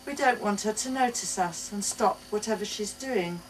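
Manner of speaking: ordinary delivery, nothing marked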